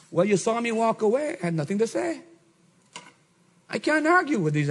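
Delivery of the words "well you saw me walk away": ordinary talk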